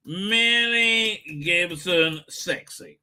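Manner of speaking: spoken slowly as he types; drawn out as he types; slowly typed search. Shown as a demonstration. Reading out his search for viewers, slowly. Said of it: "I'm gonna Google".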